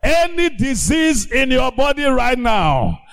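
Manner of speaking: pulpit-thumping healing decree; fiery preaching. Acted. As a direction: command the sickness out like a preacher. Preaching healing commands at full force.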